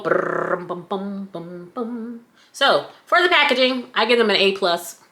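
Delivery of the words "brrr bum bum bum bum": playful vocal drumroll before the verdict; drum roll. Pretend. Vlogger building suspense for the packaging grade, mouth drumroll. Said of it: "drum roll".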